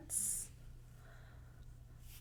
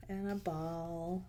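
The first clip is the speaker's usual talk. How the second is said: usual speaking voice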